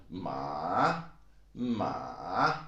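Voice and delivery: long falling-rising Mandarin "ma"; third tone. Shown as a demonstration. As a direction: drop "ma" into a valley and bring it back up. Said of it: "then it comes up again".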